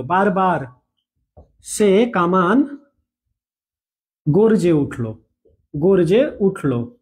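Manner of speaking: word by word, slow and spaced for students to write; slow dictation. Shown as a demonstration. Teacher slowly dictating a sentence to students, word by word. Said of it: "ঠিক আছে?".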